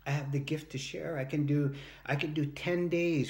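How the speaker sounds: ordinary delivery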